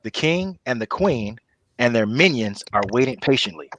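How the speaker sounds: ordinary talk, nothing marked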